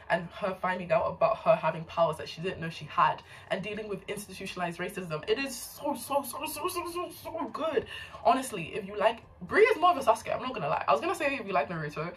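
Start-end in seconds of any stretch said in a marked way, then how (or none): 5.3-7.9 high, stretched out so so so; gushing. Real, not acted. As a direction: rave about it, pitch up and drawn out. Gushing about a favourite book.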